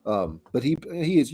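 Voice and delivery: normal talking voice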